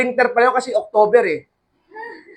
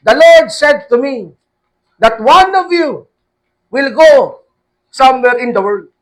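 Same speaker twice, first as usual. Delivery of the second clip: slow, loud, raised prophetic voice; prophetic pronouncement; 4 semitones higher, 6 dB louder, slower than usual. Retold or imitated. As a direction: declare it slowly like a prophetess. Prophetess quoted with God's word.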